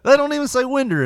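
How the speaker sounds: incredulous. Real, not acted. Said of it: "emphatic complaint".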